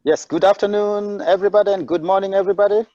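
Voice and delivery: choppy, unstable call line; bad connection. Real, not acted. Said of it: "my connection is kind of not stable".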